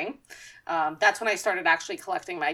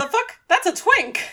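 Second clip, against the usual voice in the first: high-pitched incredulous outburst; comic double take, pitched way up; mock disbelief; 12 semitones higher, 4 dB louder than usual. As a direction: squeak it out in mock shock. Acting out shocked reaction to villain.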